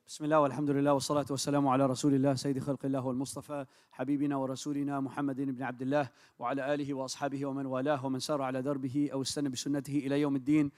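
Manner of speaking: ordinary talk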